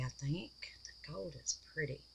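fast, breathy whisper of admiration. Real, not acted. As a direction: whisper it to yourself, quickly. Quietly admiring the gold.

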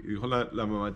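plain reading voice

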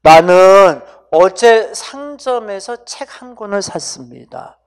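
slow, measured example reading, loud at the start; demonstration sentence. Shown as a demonstration. Teacher gives students an example sentence, slow and deliberate. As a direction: read it out slowly, dropping off at the end. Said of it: "선생님 한번 이렇게 해볼게".

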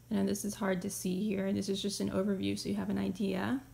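usual speaking voice

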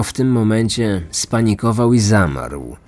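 usual speaking voice

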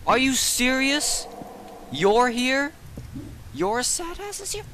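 3 can't believe he's back, voice way up; 1 incredulous outburst. Real, not acted. 4 gamer spots the returning mob, high incredulous yelling.

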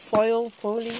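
Foil in English, then folie in Dutch, foh-lee. Shown as a demonstration. Showing viewers the English and Dutch words, Foil then folie. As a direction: contrast Foil and folie side by side. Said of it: "In Dutch you call it folie".

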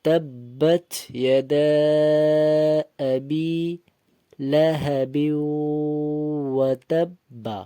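slow, drawn-out melodic recitation; Quran recitation. Shown as a demonstration. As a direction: chant the verse slowly, stretching the vowels. Teacher recites the verse slowly.

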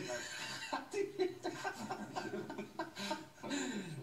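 high helpless giggling; long giggle fit. Real, not acted. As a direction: lose it to giggles. Laughing fit on air.

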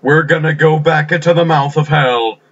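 slow, high, drawn-out war cry; rally the friends with a big heroic yell; rallying battle cry. Acted. Acted rallying cry before going back.

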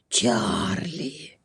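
very low, soft bear voice; amused bear. A narrator's reading. Bear gently answers the crow, low amused voice. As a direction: drop right down and draw the name out. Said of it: "розсміявся ведмедик".

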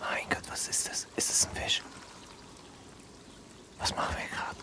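hushed whisper. Acted. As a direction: keep it to a whisper. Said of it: "Ich flüster einfach weiter".

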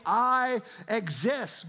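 I exist, dragged very slow and loud; slow emphatic I exist. Shown as a demonstration. Preacher declaring God's words to the church, slow and emphatic. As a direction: draw out "I exist" word by word.

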